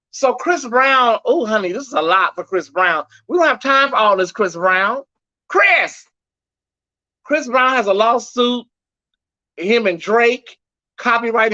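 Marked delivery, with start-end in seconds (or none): 5.5-6.1 high calling shout of his name; a sudden high call. Pretend. Calling out to [name].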